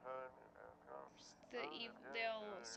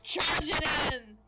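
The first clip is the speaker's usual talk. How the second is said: battle cry; 5 semitones higher, 10 dB louder, much slower than usual. Pretend. Acting out a charge.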